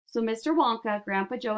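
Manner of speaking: usual speaking voice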